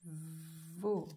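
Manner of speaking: VEAU with a held v; drawn-out word. Shown as a demonstration. Teacher writing veau for pupils, drawn out. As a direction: stretch the start of veau.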